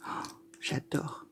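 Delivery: hushed, savouring whisper of delight; very soft, whispered swoon over a lovely smell. Real, not acted. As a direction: breathe it out in a slow whisper.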